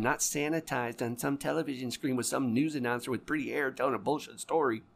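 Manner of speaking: usual speaking voice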